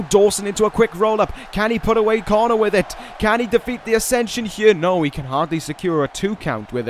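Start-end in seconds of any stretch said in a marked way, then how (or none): 0.0-4.7 excited call. Real, not acted. Live call of a near fall.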